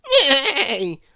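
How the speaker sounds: loud, high, wavering neigh; whinny like a horse. Acted. Old horse in the farmyard tangle, whinnying. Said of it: "whined the old farm horse".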